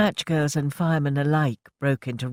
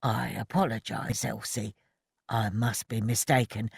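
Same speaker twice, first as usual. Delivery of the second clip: soft, lower apology; subdued; 4 semitones lower, 6 dB quieter than usual. A narrator's reading. Ted apologising to Elsie, soft and subdued. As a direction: back down quietly.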